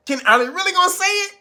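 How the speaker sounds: high, fast, near-shouting disbelief; excited outburst. Real, not acted. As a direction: shoot the question up high and quick.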